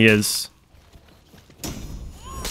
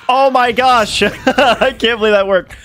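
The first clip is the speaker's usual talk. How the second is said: excited shriek; 11 semitones higher, slower than usual. Real, not acted. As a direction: shriek with joyful disbelief. Shocked the trick worked.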